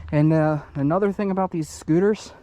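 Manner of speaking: normal talking voice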